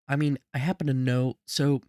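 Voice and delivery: ordinary talk, nothing marked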